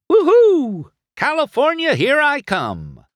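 high, loud whoop, easing down at the end; jubilant shouting. A narrator's reading. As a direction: whoop as you zip away. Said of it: "shouted loudly as he zipped away".

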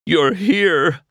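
high, loud cry of relief; emotional cry. A narrator's reading. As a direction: cry out overjoyed to find him. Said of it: "Mac cried".

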